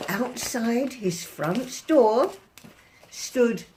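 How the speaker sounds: ordinary delivery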